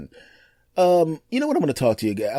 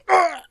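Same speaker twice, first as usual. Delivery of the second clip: sharp high cry of pain; 3 dB louder than usual. Retold or imitated. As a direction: yelp as if a bump jolts an aching body. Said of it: "he would be go".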